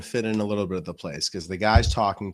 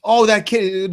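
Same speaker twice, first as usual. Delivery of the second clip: eager Mikey; 10 semitones higher, 6 dB louder, slower than usual. Retold or imitated. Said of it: "Yeah, he's".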